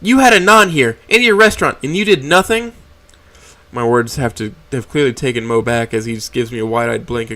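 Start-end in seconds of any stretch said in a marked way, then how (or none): 0.0-2.7 louder, higher, outraged; enraged accusation at the boss; furious accusation. Acted. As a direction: hurl the accusation at him.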